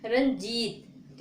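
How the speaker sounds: Ranjit stretched very slowly, syllable by syllable; teacher drawing out Ranjit for students; slow sounding out. Shown as a demonstration. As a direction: draw out Ranjit so each sound is heard. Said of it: "क्या लिखा है".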